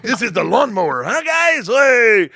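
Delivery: loud and high, rising to a yell; rowdy frat-boy clowning. Retold or imitated. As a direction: play to a drunk crowd for laughs.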